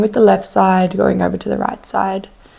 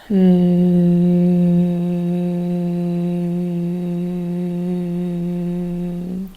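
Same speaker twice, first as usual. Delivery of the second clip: bee hum; 2 semitones lower than usual. Shown as a demonstration. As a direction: hold one even hum like a bee.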